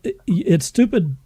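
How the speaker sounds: ordinary talk, nothing marked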